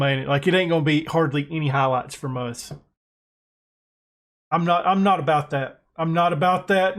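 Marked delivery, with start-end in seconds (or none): none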